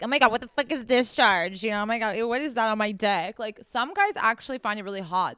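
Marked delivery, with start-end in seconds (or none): 0.0-3.4 louder, mildly hammed-up disgusted guy; mock outrage of an average Joe; mock grossed-out guy. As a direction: imitate an appalled guy, loudly. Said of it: "The average Joe Schmo probably is like".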